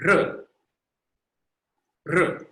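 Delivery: trilled R, tongue rolling; rolled R. Shown as a demonstration. Teacher demonstrating a rolled R for the class. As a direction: roll the R hard on the tongue. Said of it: "this is what it sounds like".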